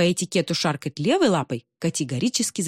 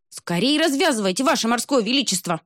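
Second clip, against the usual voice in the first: clucking; 6 semitones higher than usual. Acted. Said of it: "заквохтал альбатрос".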